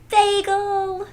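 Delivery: high, loud cry of the dog's name; joyful cry. A narrator's reading. Girl spots her lost dog, high joyful loud cry. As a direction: call out in relief and joy. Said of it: "Nellie cries".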